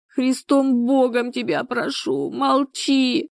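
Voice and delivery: high, tearful begging; sobbing plea. A narrator's reading. Людмила begs Галя to keep her shame secret, sobbing. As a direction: beg as if sobbing at her feet. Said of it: "принялась рыдать".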